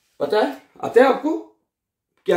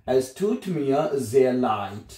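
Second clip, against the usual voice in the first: slow model phrase; 2 semitones lower, much slower than usual. Shown as a demonstration. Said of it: "teacher models German phrase slowly".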